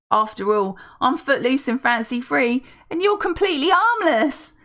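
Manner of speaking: rising high, loud lady voice; gushing older woman. Acted. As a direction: gush without thinking. Felicity hooks Arthur's arm.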